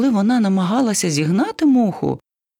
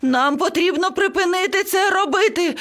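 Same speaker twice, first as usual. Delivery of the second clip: high, panicked parrot shrieking; frantic high-voiced yelling; screaming; 11 semitones higher than usual. A narrator's reading. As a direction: cry out in a panic, pitched very high. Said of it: "зарепетував папуга".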